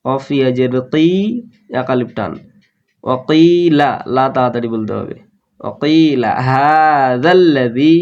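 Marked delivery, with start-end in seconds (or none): 6.5-7.9 হাযাল্লাযী slow, long ha, doubled l; হাযাল্লাযী chanted slowly with the ll doubled; drawn-out tajweed. Shown as a demonstration. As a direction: stretch হাযাল্লাযী, holding the ll. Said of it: "হা এক আলিফ টান দিয়ে".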